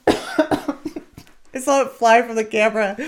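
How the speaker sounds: slow, coughing after swallowing a bug. Real, not acted. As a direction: still clearing the throat. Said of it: "I just swallowed a gnat".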